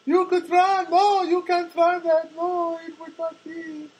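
high, slow Czech mail lady voice; Czech accent impression. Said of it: "That's how she talks".

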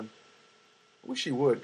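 ordinary delivery

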